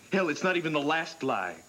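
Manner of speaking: bitter accusation. Acted. Husband accusing Tina.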